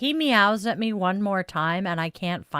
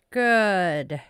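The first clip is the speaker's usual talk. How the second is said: normal talking voice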